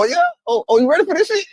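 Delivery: a high, loud, rising dare to fight; hyped challenge. Retold or imitated. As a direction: bring it on, pumped up. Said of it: "You know my response is".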